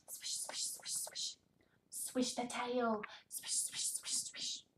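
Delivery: soft breathy whisper; whispered sound words. Shown as a demonstration. Reading to toddler, hushed whisper. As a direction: whisper each word to draw him in.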